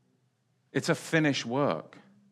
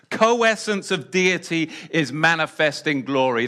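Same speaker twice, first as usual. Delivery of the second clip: ordinary delivery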